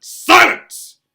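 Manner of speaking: huge rasping yell. Acted. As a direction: bellow to shut him up. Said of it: "yelled Uncle Vernon".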